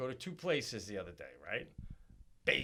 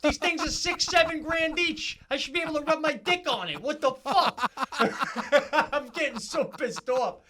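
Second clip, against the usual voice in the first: loud, high, worked-up rant; building outrage, shouting it out; real fury with laughs breaking in; angry rant; 9 dB louder than usual. Real, not acted. Ranting about piano store.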